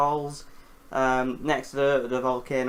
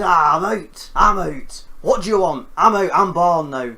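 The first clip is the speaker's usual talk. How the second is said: loud hammed-up hatchling shout, higher; newborn monster yelling; 3 semitones higher, 9 dB louder than usual. Rodan hatching from the egg, loud mock yell. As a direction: bellow it like a creature just smashing out of its egg.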